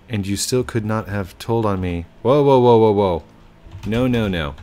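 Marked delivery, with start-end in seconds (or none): 2.2-4.6 loud whoas to cut someone off; loud halting whoa whoa; stopping whoa. Real, not acted. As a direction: hold it right there, loudly.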